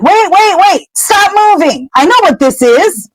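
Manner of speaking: high-pitched alarmed command; acted-out panicky warning; urgent warning. Urgent warning voiced in story.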